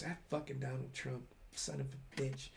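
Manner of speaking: mock angry mutter. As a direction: grumble it under your breath. Coworker muttering angrily, retold.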